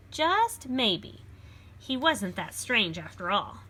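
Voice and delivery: put-on lively read-aloud for children; storybook reading. A narrator's reading. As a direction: read it like a picture book to a class. Reads picture book aloud.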